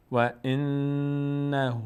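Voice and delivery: slow, drawn-out chanted Arabic recitation; slow Quran recitation. Shown as a demonstration. Teacher slowly reciting the verse for the student, chanted. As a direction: stretch every syllable as in tajweed. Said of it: "teacher recites verse".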